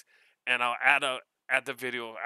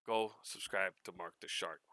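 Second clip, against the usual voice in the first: usual speaking voice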